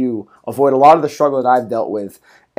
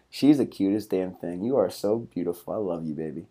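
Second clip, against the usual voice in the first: doting dog talk; 7 semitones lower, 12 dB quieter than usual. Real, not acted. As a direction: coo softly to your puppy. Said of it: "That was me talking to Buddha".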